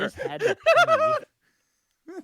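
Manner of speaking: very high, slow, through laughter; laughing excuse. Real, not acted. Friend laughing an excuse for leaving early. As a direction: squeak it out laughing.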